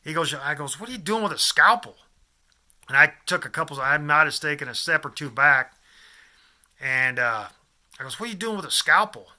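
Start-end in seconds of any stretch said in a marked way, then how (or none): 0.8-2.0 higher, louder retold disbelief; alarmed retort. Storyteller to man holding a scalpel, acted alarm. 8.2-9.3 retold startled question, pitched up; alarmed retort. Storyteller confronting man with a scalpel, acted alarm.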